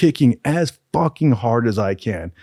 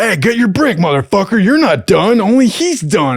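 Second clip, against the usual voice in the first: much higher and louder, a barked drill-instructor yell; 8 semitones higher, 6 dB louder than usual. Retold or imitated. Instructor yelling at a trainee to get his brick, loud mock yell. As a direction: bark it at the trainee like a furious instructor.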